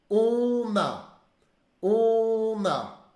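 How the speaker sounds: on a said slowly with a nasal on; French on a. Shown as a demonstration. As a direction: model on a for the class to repeat. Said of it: "repete comigo". (pronúncia de on a em francês)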